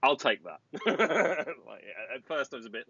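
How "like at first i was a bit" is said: normal talking voice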